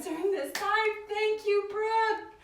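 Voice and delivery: high-pitched, delighted gratitude; sing-song, effusive appreciation; gushing thanks. Real, not acted. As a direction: lift the voice high in heartfelt thanks.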